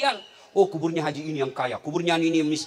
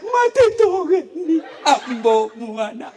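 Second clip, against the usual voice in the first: higher, louder hammed-up wailing in local dialect; mock wailing widow; 10 semitones higher, 8 dB louder, slower than usual. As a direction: imitate a grieving woman wailing, laughing through it. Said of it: "Ada orang meninggal suaminya". (meniru ratapan janda, dilebih-lebihkan sambil tertawa)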